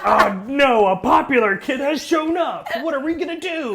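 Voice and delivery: high, laughing mock dismay; mock bullies panicking. Storyteller mocking the bullies' panic, laughing.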